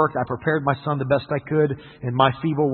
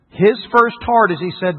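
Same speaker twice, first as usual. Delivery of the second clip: usual speaking voice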